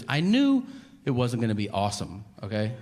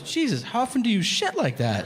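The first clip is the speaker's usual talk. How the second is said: high, laughing mock shock; shocked wife; 10 semitones higher than usual. Wife asking her husband, high and laughing in shock. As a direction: gasp it out, half laughing.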